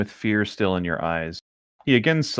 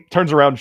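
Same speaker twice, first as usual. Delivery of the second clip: imitated gasp; 5 semitones higher, 5 dB louder than usual. Acted gasp of priest.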